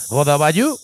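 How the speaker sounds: Belgian-style "Rodaballo" with a throaty r; funny foreign "Rodaballo"; mock Belgian accent. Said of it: "Si digo".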